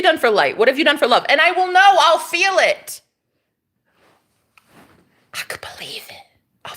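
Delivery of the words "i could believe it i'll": breathy whispered aside. Real, not acted. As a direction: say it under your breath. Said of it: "whispered aside".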